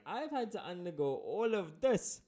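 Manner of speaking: high, loud, incredulous fisherman rant; hammed-up indignant squawk, climbing in pitch; sarcastic outrage. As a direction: throw it back at the customer, scandalized. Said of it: "a rather sarcastic sentence like".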